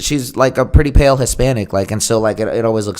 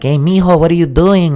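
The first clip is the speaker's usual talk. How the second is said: high-pitched Colombian mom impression; 6 semitones higher than usual. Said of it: "your mom sounding like a Colombian lady".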